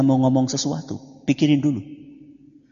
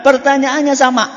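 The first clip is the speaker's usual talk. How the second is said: raised preaching voice; 11 semitones higher, 8 dB louder than usual. Acted. Preacher quotes a hadith aloud.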